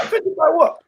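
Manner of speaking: high, puzzled offended by what; mock-innocent high question; incredulous reply. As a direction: play dumb, pitch jumping up. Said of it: "I'm like".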